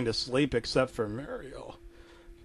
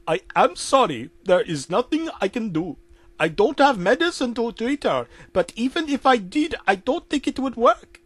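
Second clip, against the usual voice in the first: put-on higher Indian-accented apology; 8 semitones higher than usual.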